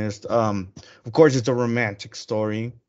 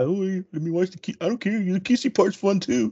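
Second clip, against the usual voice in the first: higher-pitched mimicked kid voice; Fred Savage; 7 semitones higher than usual. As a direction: mimic an eager boy, pitched up high. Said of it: "'Cause Fred Savage was all about".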